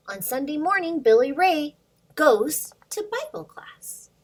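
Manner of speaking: put-on lively read-aloud for little kids; storybook reading. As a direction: read the rhyme to children, bouncing along. Reads picture book to kids.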